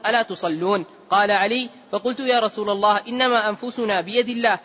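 usual speaking voice

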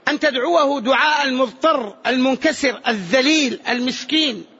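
ordinary talk, nothing marked

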